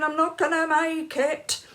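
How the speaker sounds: hammed-up desperate cry; panicky wail. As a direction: keep wailing down the hall. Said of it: "still going".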